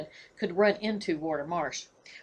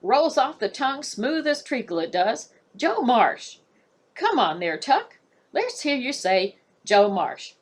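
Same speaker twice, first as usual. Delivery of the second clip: higher, louder sing-song coaxing; coaxing warder; 7 semitones higher, 4 dB louder than usual. Acted. Warder sweetly coaxes the raven to say his name. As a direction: coax it like a treat.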